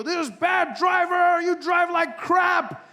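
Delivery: high, stretched mock yell; slow, high-pitched angry shout; road rage. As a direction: rant at another driver in hammed-up rage. Imagined road rage outburst.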